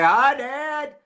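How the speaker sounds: high, loud greeting called out to Dad; calling out. Acted. Host leads viewers greeting his dad, loud and high. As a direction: sing out a hello to Dad across the yard.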